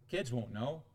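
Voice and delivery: low, soft, quick self-assurance; dismissive dad. Acted. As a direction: play down the secret quietly. Voices a dad excusing his secret sin.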